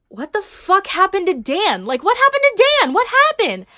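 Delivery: high, loud, stunned outcry; shocked disbelief. Retold or imitated. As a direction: yell it in total shock at the news. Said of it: "And I was like".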